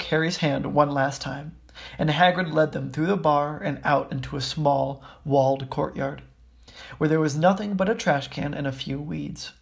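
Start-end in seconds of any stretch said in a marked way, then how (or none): none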